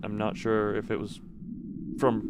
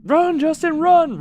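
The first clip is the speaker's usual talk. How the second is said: much higher, louder yelled taunt; piercing mocking shout at Justin; kids shouting; 10 dB louder, slower than usual. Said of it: "heard the kids shout".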